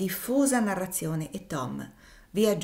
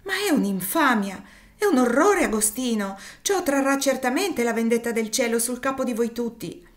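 much higher and louder, climbing to a cry; furious outburst; 5 semitones higher, 6 dB louder than usual. Acted. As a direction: denounce it with all her might. Ophelia denounces the cruelty.

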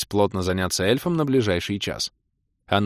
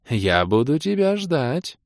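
slow, high, lingering promise; demoness promise; 6 semitones higher, slower than usual. A narrator's reading. Demoness parts from the elf, drawling a promise. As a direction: draw it out coaxingly. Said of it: "пообещала демоница".

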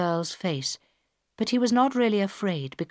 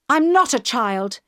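angry; 6 semitones higher, 5 dB louder than usual. A narrator's reading. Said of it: "she said angrily".

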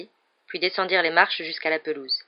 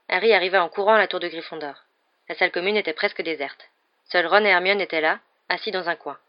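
plain reading voice

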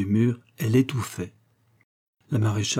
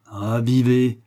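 sustained, declaimed command; oracle priestess; 4 dB louder, much slower than usual. A narrator's reading. Maréchale commands Adam to live, oracle-like. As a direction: proclaim it like a prophetess on her tripod. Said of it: "d'un accent de pythonisse sur son trépied".

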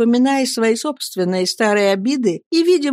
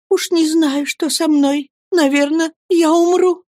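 put-on higher, helpless young woman; a scared widow afraid she'll die; frightened young mother; 10 semitones higher, 4 dB louder than usual. (испуганный высокий голос матушки)

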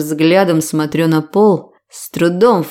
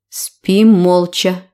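ordinary delivery

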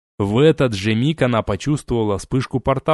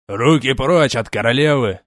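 loud commanding shout; 4 dB louder than usual. Acted. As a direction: bark it as a warning. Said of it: "вскричал поднимающийся на ноги сотник".

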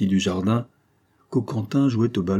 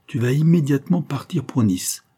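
an order, a touch higher; commanding; 6 semitones higher than usual. A narrator's reading. Villainess sending Morales off to Nice, mild command. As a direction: give a firm order to leave. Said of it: "ordonna la Monty".

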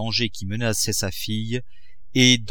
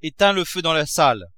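shouted order; 6 semitones higher, 4 dB louder than usual. A narrator's reading. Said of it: "cria-t-il".

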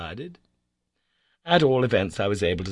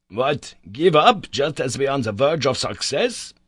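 loud, high incredulous question; outraged cry; 5 semitones higher, 7 dB louder than usual. A narrator's reading. Professor scorning the idea of giving up, crying out. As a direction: cry it out, pitched up. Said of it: "he cried".

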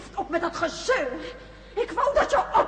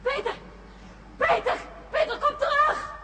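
calling after him; 9 semitones higher than usual. Acted. Erika calls Peter back.

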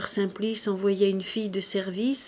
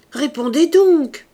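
loud, high, drawn-out cry; insistent shout at raised pitch; shouted command; 7 semitones higher, 12 dB louder, slower than usual. A narrator's reading. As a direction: shout it, demanding an answer. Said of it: "cria Fantine".

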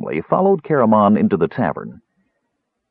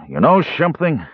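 ordinary delivery

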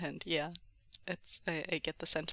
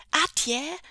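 high, loud greeting with a lift; excited rising shout; child's cry; 8 semitones higher, 7 dB louder than usual. A narrator's reading. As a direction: call out like an eager child. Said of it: "Jingyi cried".

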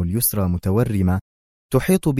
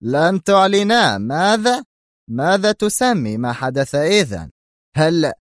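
loud, slow, much higher; indignant shout; 8 semitones higher, 4 dB louder, slower than usual. Acted. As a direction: yell it back. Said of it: "صاح به دون كورليوني في سخط".

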